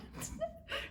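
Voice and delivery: short airy laugh up high; laughing at her funny friend, high breathy laugh. Real, not acted. Laughs at the story.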